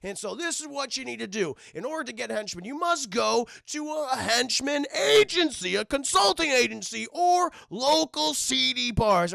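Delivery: lightly hammed motivational villain pitch; pompous villain speech. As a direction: ham up a villain recruiting speech. Max Zeus speech retold.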